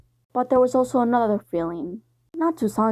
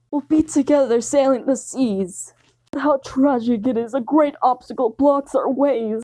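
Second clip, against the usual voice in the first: swooning lover; 3 semitones higher than usual. Acted. Sanji on one knee to the listener, swooning. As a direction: ham up a lovesick sigh.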